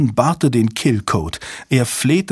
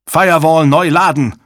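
loud, urgent raised-pitch command; shouted order; 5 semitones higher, 6 dB louder than usual. A narrator's reading. Jabba to his staff once code is confirmed, shouted order. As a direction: yell the instruction to the team. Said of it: "schrie Jabba".

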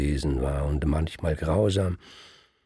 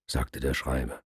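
ordinary delivery

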